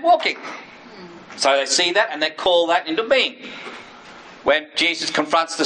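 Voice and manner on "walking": triumphant answer. Shown as a demonstration. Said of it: "What's the desired end state".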